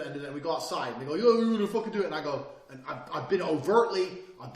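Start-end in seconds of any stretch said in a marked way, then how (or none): 1.1-2.1 loud, high-pitched goading; aggressive challenge. Retold or imitated. Attacker challenging him outside, aggressive. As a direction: imitate a rowdy challenger spoiling for it.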